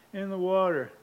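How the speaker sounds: high, mildly tearful answer; imitated crying child. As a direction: play a sorry little kid. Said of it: "And he's crying".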